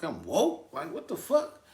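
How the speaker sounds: high, incredulous questioning; acted bewilderment. Patient reacting to nurse saying he's woke, high-pitched bewilderment. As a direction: replay baffled shock, voice leaping up. Retells bewilderment on waking in hospital.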